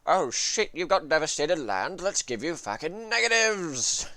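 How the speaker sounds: loud, high, hammed-up voice of the game mechanic; mocking the mandate. Streamer voicing the mandate punishing devastated land, loud and high. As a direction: shout it like a gloating system taunting you. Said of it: "the mandate just goes".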